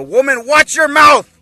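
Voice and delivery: high, loud scolding shout at his wife; mock-furious husband yelling; Vegeta. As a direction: order her sharply, at full volume. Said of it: "here's Vegeta telling his wife".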